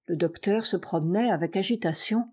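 plain reading voice

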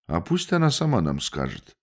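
usual speaking voice

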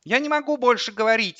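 put-on high-pitched small boy; child voice. A narrator's reading. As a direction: speak as a little boy on the phone. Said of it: "Он говорил в телефон детским голосом". (детский голос)

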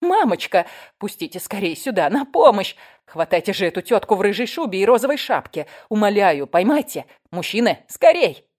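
loud, urgent cry for help; high-peaking calls for someone to grab a thief; acted yell. A narrator's reading. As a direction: plead frantically over a crowd. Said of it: "заорала я".

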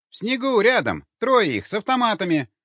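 очень высокий, громкий голос; доложи о врагах в панике; взволнованный доклад. Acted. Взволнованный стрелок докладывает о чужих. (возбуждённо, на высокой ноте)

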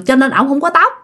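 usual speaking voice

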